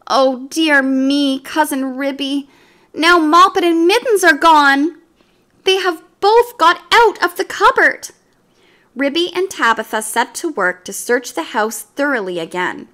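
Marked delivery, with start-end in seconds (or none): 0.1-8.1 tearful mother cat. A narrator's reading. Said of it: "said Tabitha tearfully".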